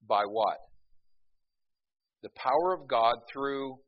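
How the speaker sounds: normal talking voice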